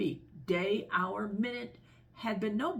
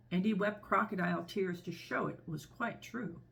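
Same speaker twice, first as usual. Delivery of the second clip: ordinary delivery, nothing marked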